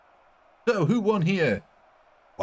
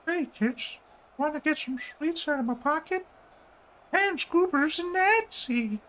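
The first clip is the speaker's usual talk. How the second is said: put-on much higher leering old man voice; a sleazy old man luring kids, pitched way up; Herbert the pervert. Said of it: "impressions can I do that one? Of Herbert the pervert".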